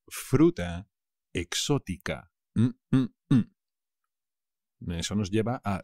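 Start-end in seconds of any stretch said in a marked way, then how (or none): 2.5-3.6 mock yum. Savouring exotic fruit.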